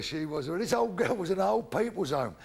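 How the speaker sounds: ordinary talk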